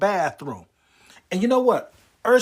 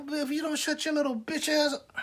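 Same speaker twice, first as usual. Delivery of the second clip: higher but much softer threat; menacing threat; 9 semitones higher, 10 dB quieter than usual. Retold or imitated. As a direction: hiss a warning quietly. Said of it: "I was like".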